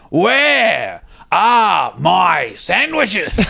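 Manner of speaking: very slow, high, drawn-out mock bellow; angry demand. Wayno demanding his missing sandwiches, slow high mock yell. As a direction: stretch every word like an enraged foreman. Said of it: "He's like".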